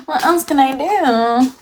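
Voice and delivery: gasp, then high, slow theatrical wail; mock lament. Streamer stuck with bad bathroom lighting, mock despair. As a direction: wail it dramatically, drawn out and high.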